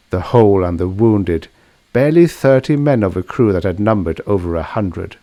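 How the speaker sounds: usual speaking voice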